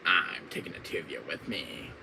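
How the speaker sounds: low, soft villain threat; menacing villain murmur; Wesker. Acted. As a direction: threaten quietly as an arch-villain. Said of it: "my Wesker voice".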